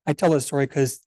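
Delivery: ordinary delivery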